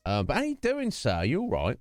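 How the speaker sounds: normal talking voice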